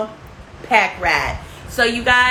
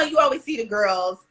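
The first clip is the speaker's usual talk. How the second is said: ordinary talk, nothing marked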